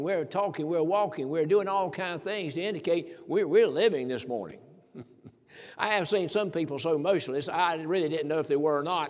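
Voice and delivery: loud, rising pulpit delivery; full-force sermon voice; fervent preaching. Real, not acted. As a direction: preach it out to the congregation.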